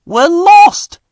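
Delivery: high alarmed cry. Acted. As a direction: driver crying out that they're lost. Driver realises they're lost.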